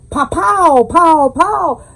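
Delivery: high, loud playful pow sounds; pow pow sound effect. Retold or imitated. Home cook showing viewers the water's heat, playful loud pows. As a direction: punch each pow like a sizzle hitting. Cooking sizzle sound imitation.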